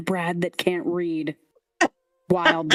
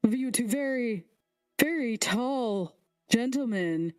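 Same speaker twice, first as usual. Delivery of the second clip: high, curious royal voice; high king voice; 5 semitones higher than usual. Acted. The king questions the party's companions, in a high voice. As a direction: question them in a high, lofty voice.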